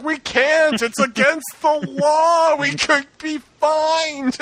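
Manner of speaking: high, slow, prissy voice; prim rule-follower. Retold or imitated. Sending up Harry for co-hosts, a high prissy stickler. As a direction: play the hero as a stickler for rules. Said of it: "And he's like".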